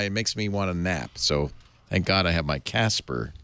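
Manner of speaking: ordinary delivery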